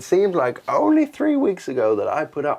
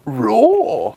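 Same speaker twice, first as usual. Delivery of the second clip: dinosaur roar; 7 semitones higher, 5 dB louder than usual. Acted. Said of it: "I need a Dexter store".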